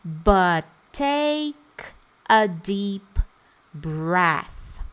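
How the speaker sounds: careful word-by-word English demo; pronunciation demo. Shown as a demonstration. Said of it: "Olha a pronúncia".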